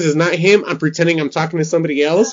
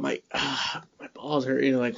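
ordinary talk, nothing marked

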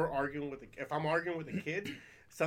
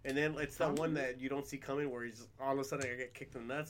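ordinary talk, nothing marked

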